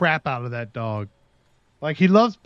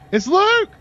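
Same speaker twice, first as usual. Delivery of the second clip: high, louder happy cry; excited recognition; 5 dB louder than usual. Retold or imitated. Neighbours spotting the missing dog, calling his name in a high, excited cry. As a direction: shout it out, delighted.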